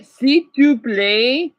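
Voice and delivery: s'il te plaît said very slowly with a rise; slow French demo. Shown as a demonstration. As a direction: spell out s'il te plaît syllable by syllable. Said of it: "And by the way".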